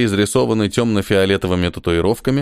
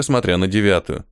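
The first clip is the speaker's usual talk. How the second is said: plain reading voice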